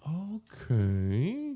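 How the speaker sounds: drawn-out, rising okay; puzzled okay. Retold or imitated. Teller reacting to a man who keeps walking, puzzled. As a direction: stretch it out, unsure, ending high.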